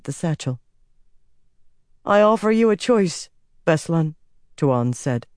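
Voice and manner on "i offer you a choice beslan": a lightly voiced empress, cool and certain; commanding empress. A narrator's reading. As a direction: lay down the law from the throne. Empress offers a choice.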